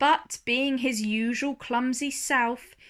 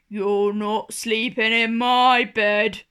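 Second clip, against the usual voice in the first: slow, louder big bear boom; 6 dB louder than usual. A narrator's reading. Big bear booms at Mister Lazy, slow and louder. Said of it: "boomed the big bear".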